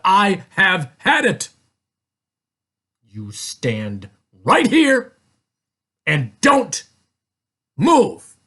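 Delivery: slow, emphatic order in separate chunks; fed-up father. A narrator's reading. Father tells Tia to stay put, exasperated command. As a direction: punch it out with stern pauses.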